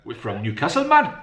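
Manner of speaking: playful Geordie voice for his son; Geordie accent. Pretend. Dad caves and does the Geordie accent for his son. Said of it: "I'm not gonna do my Geordie accent".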